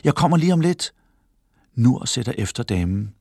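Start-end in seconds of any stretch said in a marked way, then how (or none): 0.0-0.9 calling out. A narrator's reading. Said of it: "råber hun til Talal".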